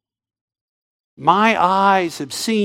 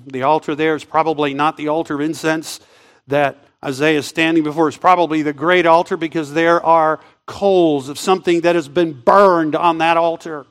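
ordinary delivery

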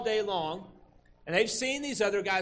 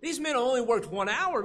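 higher, louder indignant protest; grumbling complaint; 6 semitones higher, 5 dB louder than usual. Retold or imitated. Laborers grumbling to the foreman, indignant. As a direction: voice the workers' resentment, a bit hammed-up. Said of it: "They're grumbling".

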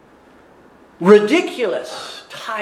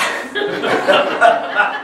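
burst of laughter; 5 semitones higher, 4 dB louder than usual. Real, not acted. Laughing at himself.

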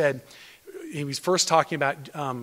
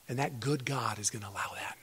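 much softer whispered assurance; soft hushed whisper; 6 semitones lower, 11 dB quieter than usual. Real, not acted. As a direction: quietly reassure them. Preacher whispers reassurance.

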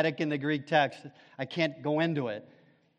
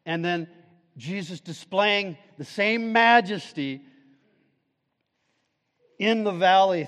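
a lift into sermon voice, higher and louder; preaching; 5 semitones higher, 9 dB louder than usual. Acted. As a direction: preach the misery, then the mercy. Preacher rises again.

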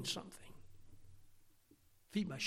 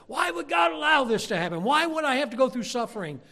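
higher, much louder shouted protest; anguished outcry; 10 semitones higher, 10 dB louder than usual. Retold or imitated. Someone questioning God's suffering, higher and shouting. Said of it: "someone not long ago say".

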